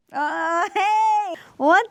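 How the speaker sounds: high, loud startled yelp; shocked outburst; surprised shout. Real, not acted. The light goes wrong again.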